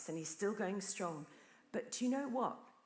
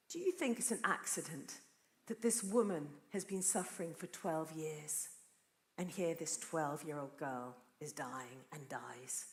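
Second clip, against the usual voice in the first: normal talking voice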